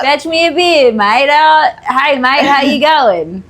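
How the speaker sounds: high, exaggerated Aussie mateyness; broad Australian accent. Shown as a demonstration. Showing friend Aussie phrases, high broad Australian accent.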